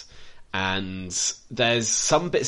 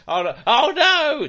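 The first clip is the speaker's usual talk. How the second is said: very high, loud hammed-up shriek; mock panic; 7 dB louder than usual. Critic spoofing a victim's death, hammed-up high panic. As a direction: squeal it like a doomed victim in a spoof.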